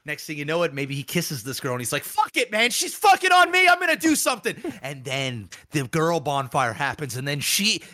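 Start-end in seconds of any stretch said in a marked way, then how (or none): 2.0-4.6 very high mock-outraged yelp; worked-up defiance. Guy justifying kissing this girl, hammed-up defiance. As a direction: act out a jilted guy losing it. Voicing cheated-on guy deciding revenge.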